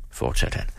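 usual speaking voice

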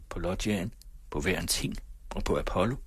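ordinary delivery

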